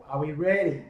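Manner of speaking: ordinary delivery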